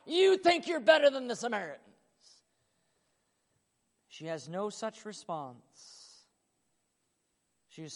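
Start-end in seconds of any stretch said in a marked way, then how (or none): 0.1-1.8 indignant shout. Pretend. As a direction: snap back in mock outrage. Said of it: "He's waiting to see".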